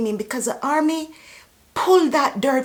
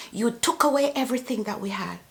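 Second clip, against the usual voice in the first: usual speaking voice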